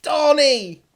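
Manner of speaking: put-on excited shout in a thick New England accent; high, loud New England yell. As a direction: holler at a celebrity like a starstruck Bostonian. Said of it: "That was a good accent".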